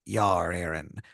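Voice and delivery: slow, hushed pirate rasp; pirate tomato. Acted. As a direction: whisper it like a conspiring pirate. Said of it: "he goes".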